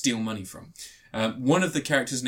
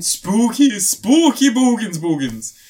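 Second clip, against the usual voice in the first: high, loud, slow ghostly wail; louder, higher spooky moan; 8 semitones higher, 9 dB louder, slower than usual. Pretend. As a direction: ham up a ghost story. Halloween spooky voice.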